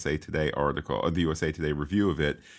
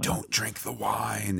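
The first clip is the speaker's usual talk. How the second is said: hidden whisperer; 4 semitones higher, 4 dB quieter, slower than usual. Retold or imitated. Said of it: "somebody behind ... that's like".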